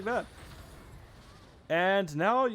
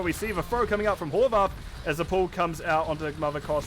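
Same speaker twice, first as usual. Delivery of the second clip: usual speaking voice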